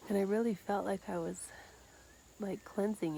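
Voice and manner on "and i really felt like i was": ordinary talk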